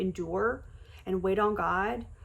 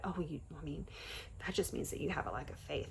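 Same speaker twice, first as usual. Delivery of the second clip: lowered, much softer mock of a judgy voice; mocking quote; 5 semitones lower, 14 dB quieter, faster than usual. Mocking church gossip who blame her, much softer and lower. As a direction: quietly mimic the smug critics. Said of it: "this lie that people wanna spread".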